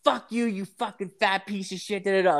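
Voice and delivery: high-pitched imitation of a furious rant; mock angry yelling. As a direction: mimic an irate opponent hurling insults. Retold quarrel, other driver yelling back.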